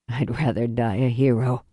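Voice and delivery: low, weary man's voice; dying father. Acted.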